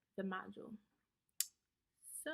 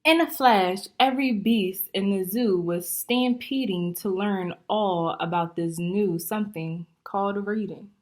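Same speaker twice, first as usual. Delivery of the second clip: lively, bouncy rhyming storybook reading; story read-aloud; 2 semitones higher, 6 dB louder than usual. Acted. Teacher reading a rhyming book to young students, lively. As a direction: read it out to the class, full of life.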